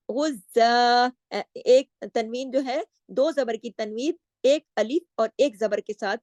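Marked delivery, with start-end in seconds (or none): 0.1-1.1 गुज़्ज़ा slow, ending on a long a; stop form demo. Shown as a demonstration. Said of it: "वक्फ आर्जी तौर पर".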